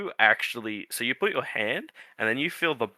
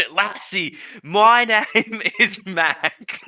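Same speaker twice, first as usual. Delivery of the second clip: higher, drawn-out Scottish sliding into Australian; hammed-up Scottish tree, half Australian; botched Scottish accent; 7 semitones higher, much slower than usual. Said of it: "They're all just gonna turn Australian".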